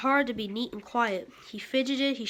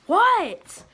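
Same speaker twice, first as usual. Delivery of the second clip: loud high what; 8 semitones higher, 12 dB louder than usual. Acted. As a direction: shout it back high. Froggy answers father.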